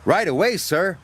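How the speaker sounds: eager whistle. A narrator's reading. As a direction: answer keenly at once. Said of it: "whistled Thomas".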